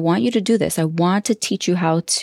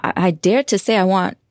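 ordinary talk

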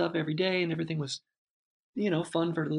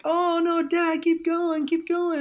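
much higher and louder, imitating his kids pleading; kids begging; 13 semitones higher, 6 dB louder, slower than usual. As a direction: echo the kids begging Dad to keep going, slowly. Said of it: "And they were like".

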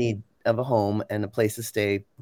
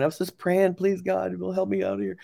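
tearful prayer; 7 semitones higher than usual. Retold or imitated. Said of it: "started crying hysterically and then praying".

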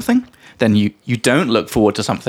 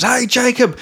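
retold scream; 11 semitones higher, slower than usual. Food seller hailing a passer-by, mock yell. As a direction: imitate a distant holler at contained volume. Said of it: "he screams at the top of his lungs".

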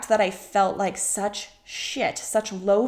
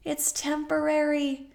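emphatic repeat; 7 semitones higher than usual. Shown as a demonstration. Coach reassuring listeners, repeating it higher for emphasis. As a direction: lift "It's temporary" up high to drive it home. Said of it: "repeated after plain take".

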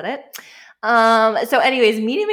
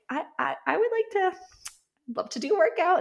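eager asking voice; 10 semitones higher, faster than usual. Retold or imitated. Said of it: "And I'm like".

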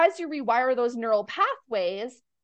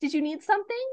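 put-on high, light greeting to a child; gentle mom voice; 9 semitones higher than usual. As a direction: warmly check in with your little boy. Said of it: "turns everything off for a second".

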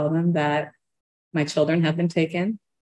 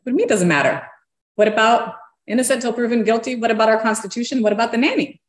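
higher, pressing disbelief; indignant protest; 6 semitones higher, 4 dB louder, faster than usual. Retold or imitated. Client protesting to her lawyer, indignant. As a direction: challenge the answer in a raised pitch.

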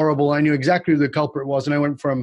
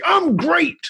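fake upbeat. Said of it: "I'd say".

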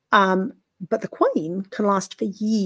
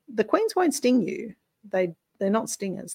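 ordinary talk

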